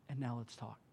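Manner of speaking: very soft and low; volume turned down. Acted. God to Elijah after silencing the turmoil, hushed and low. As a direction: drop right down to a calm, intimate hush. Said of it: "just gonna turn it all down".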